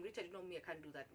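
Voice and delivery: soft, low, restrained refusal; keeping cool. Retold or imitated. Applicant refusing boss while agitated inside, keeping cool. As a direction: hold it in and decline quietly. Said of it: "trying to keep my cool so bad".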